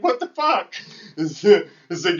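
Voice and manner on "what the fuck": high, stunned what-the-fuck; retold disbelief. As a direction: pitch it way up in shock. Retelling being tricked.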